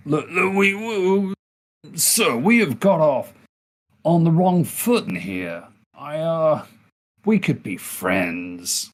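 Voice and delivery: butter up a bully, squeaky and placating; pleading charm. Acted. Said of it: "I would like to attempt to charm him".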